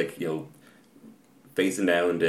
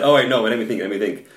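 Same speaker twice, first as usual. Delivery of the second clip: louder, higher retold flinch, easing off; startled backtrack; 4 semitones higher, 7 dB louder than usual. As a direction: mimic an opponent suddenly jumping back. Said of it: "he just jumped back and said".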